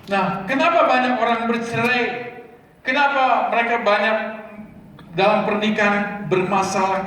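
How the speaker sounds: high and loud, pressing each point; full-force preaching. Acted. Pastor preaching to the congregation about marriage, loud and fervent. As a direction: drive the message home to the congregation.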